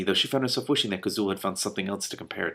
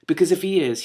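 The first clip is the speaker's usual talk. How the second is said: higher, louder indignant burst; 10 semitones higher, 7 dB louder than usual. Acted. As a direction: protest it hotly. Princess indignant.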